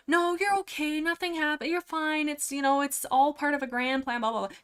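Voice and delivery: high, sugary mock comfort, far above her own voice; mock coddling. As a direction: coo it like you're babying someone, then trail off. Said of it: "she doesn't coddle her".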